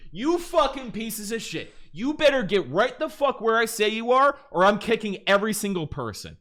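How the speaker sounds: toxic angry shot caller. Retold or imitated. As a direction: imitate a toxic leader berating the team at full volume. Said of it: "is actually really toxic. He's just like".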